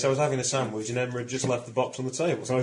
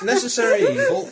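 laughing; 11 dB louder than usual. Real, not acted. Still laughing at own joke.

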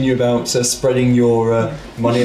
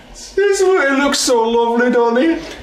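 high NPC voice; 11 semitones higher than usual. Acted.

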